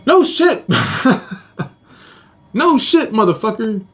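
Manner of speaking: high-pitched sarcastic outburst; high, exasperated jab; mock incredulous. Sarcastic reaction to the news.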